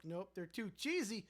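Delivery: ordinary delivery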